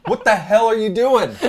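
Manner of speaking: loud scolding wife voice; mock angry wife. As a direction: yell at him like an annoyed wife. Voicing Scott's wife scolding.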